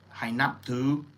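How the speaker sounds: Hai naptu said slowly in Thai, ending on a rise; Hai naptu, careful Thai demo; Thai phrase. Shown as a demonstration. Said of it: "that is to give respect".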